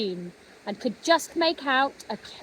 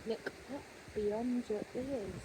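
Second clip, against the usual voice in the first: much softer, like a fading echo; 15 dB quieter than usual. A narrator's reading. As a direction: let the words drift away. The voice repeats, fading.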